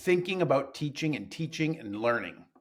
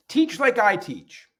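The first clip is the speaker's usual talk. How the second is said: slow, higher, louder mock order; mock bossy teacher; 9 semitones higher, 6 dB louder, slower than usual. Teacher parodying a mentor, slow loud mock order. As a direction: spell it out like a preachy mentor. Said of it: "I don't do that".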